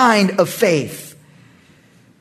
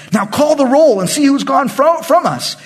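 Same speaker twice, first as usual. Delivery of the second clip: Saul's order; 8 semitones higher, 3 dB louder than usual. Acted. Saul orders roll call.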